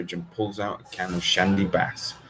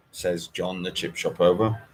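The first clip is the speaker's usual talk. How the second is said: usual speaking voice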